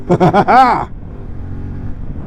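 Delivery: breathy, giddy laughter; thrilled laugh. Real, not acted. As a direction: laugh from the rush of speed. Laughing after the acceleration.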